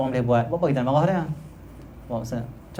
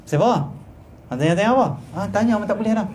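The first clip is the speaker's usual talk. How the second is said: raised-pitch irritable snapping; acted grumpy person; 7 semitones higher than usual. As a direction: play someone who resents being asked. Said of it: "Orang pemarah". (lakonan si pemarah)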